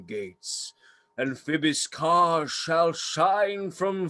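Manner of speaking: slow, booming mock-heroic rant; ranting tyrant. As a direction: declaim it like a stage tyrant. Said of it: "This was lofty".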